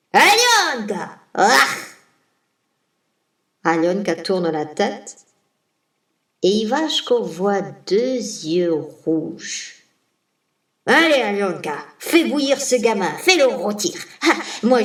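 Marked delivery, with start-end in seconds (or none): 0.1-1.7 high, loud calling shout; Baba Yaga's shout. Acted. Baba Yaga calls her daughter again. 10.9-14.8 louder bossy witch voice; a lightly voiced witch ordering her daughter; Baba Yaga's orders.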